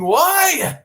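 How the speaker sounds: mock shout. Loud mock cry building up.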